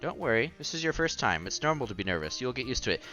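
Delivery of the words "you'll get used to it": ordinary talk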